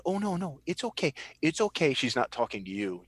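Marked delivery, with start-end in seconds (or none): 0.1-1.9 high-pitched, hushed-urgency reassurance; Noella. Acted. Game master as Noella soothing the old lady, high-pitched reassurance. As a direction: reassure them in a raised character voice. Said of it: "you hear Noella saying".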